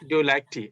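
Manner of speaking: "Do you like tea?" falling at the end like a statement; wrong tone for question. Shown as a demonstration. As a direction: say "Do you like tea?" without any rise on "tea". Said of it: "এটা হবে না".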